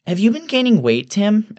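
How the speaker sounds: very high-pitched pondering woman; thoughtful Evelyn. A narrator's reading. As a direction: needle him with musing innocence. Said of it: "Evelyn asks thoughtfully".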